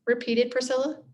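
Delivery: plain reading voice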